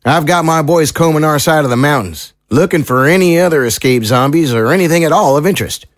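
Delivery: folksy small-town sheriff, resolute. Acted. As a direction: play a sheriff promising real effort. Sheriff figure reassures the captives.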